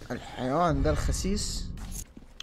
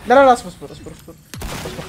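panicked yell; 5 semitones higher, 11 dB louder than usual. Real, not acted. Panicking in a fight.

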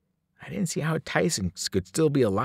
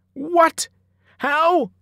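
much higher and louder, disbelieving; shocked outburst; 5 dB louder than usual. A narrator's reading. Headmaster shocked at burning gym.

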